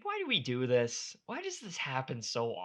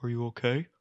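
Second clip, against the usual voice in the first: lightly voiced doctor, a bit louder; mock doctor voice; 4 dB louder than usual. Host mocking the cageside doctor, mock doctor voice. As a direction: ask it like a clueless doctor.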